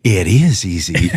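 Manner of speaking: slow, dry, knowing cowboy drawl; deadpan reply. Retold or imitated. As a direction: hand back a dry knowing answer. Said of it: "this guy looks over at me and goes".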